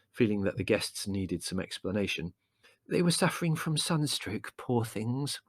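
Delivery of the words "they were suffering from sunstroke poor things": explanatory mother. A narrator's reading. Mother explaining gently to guests.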